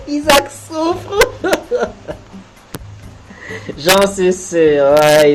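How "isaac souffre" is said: slow giggle; laughing. Real, not acted. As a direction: giggle the words out slowly. Laughing slowly. (en gloussant)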